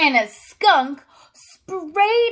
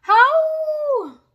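much higher, long falling howls; wolf howls; 13 semitones higher than usual. Acted. Wolves answer the dog with high falling howls. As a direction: howl back like a far-off wolf pack.

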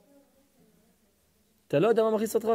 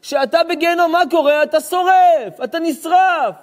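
higher, louder preacher's cry; fiery preaching; 8 semitones higher, 6 dB louder than usual. Acted. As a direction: thunder it from the pulpit. Preacher rises to full force. (הטפה נלהבת בקול רם)

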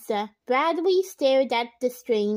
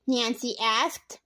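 ordinary delivery

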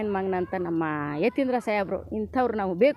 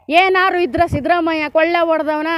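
louder, challenging shout; heated street-side shout defending his leader; indignant outburst; 5 semitones higher, 7 dB louder than usual. Real, not acted. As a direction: throw the charge back at them hard.

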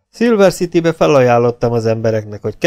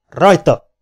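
higher, louder call; command to start; 4 semitones higher, 5 dB louder than usual. A narrator's reading. Bandit starts the count for the duel, commanding. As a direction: give the starting signal out loud.

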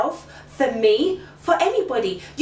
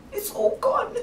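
voice breaking; 10 semitones higher, 4 dB quieter than usual. Acted. Voice breaking high.

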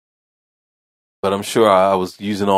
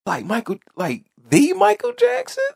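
excited caller. Retold or imitated. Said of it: "the guy was like".

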